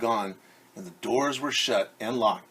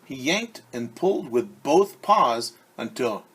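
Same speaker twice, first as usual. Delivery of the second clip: ordinary delivery, nothing marked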